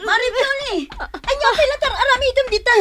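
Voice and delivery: high, loud hurried urging; urgent alarm. Acted. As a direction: press them to hurry. Neighbour rushing to help. (napigsa ken maaburido)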